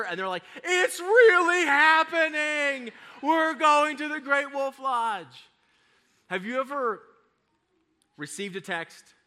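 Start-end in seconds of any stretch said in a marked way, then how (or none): 0.6-5.5 high, loud, drawn-out imitation of thrilled children; mock childlike squeal of triumph; overjoyed kids. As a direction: cheer it slowly in a kid's big voice. Kids convinced, cheering.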